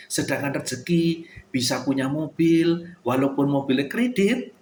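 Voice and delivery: ordinary talk, nothing marked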